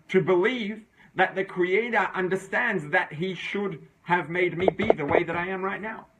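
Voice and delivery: usual speaking voice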